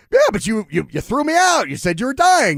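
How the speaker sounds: high, loud indignant protest; playfully indignant grandson; mock argument. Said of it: "funny little argument".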